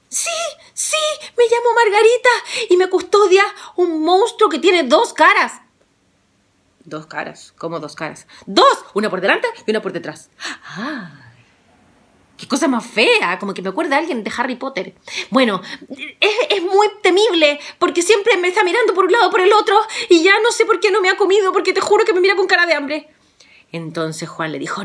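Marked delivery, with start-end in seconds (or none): 0.1-5.6 put-on much higher, louder girlish answer; a scared princess explaining her guard; tearful princess. Margarita tells Juan about her monster, high and girlish. 6.8-8.2 much softer, lower bewildered question; puzzled hushed question. Acted. Juan hears of the two-faced monster, hushed and puzzled. 8.4-23.1 much higher, louder and fast worried chatter; frightened chatty princess. Acted. Margarita describes the two-faced monster, high and fast.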